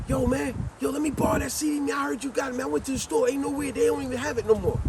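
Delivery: high, pleading buddy voice; family member begging. Retold or imitated. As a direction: beg like the cousin who wants the CD. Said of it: "this family member said".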